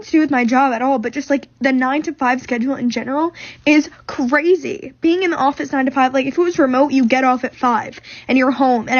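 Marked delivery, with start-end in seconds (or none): none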